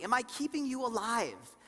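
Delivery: usual speaking voice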